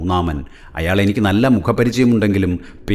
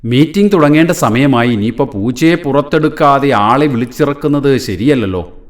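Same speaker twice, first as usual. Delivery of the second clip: higher, louder call, voice raised; 6 semitones higher, 4 dB louder than usual. Acted. Ananthan urges Kasim about the meeting, voice raised loud. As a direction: say it out loud to carry. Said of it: "ഒച്ചയുയർത്താതെ പറയുന്നത്".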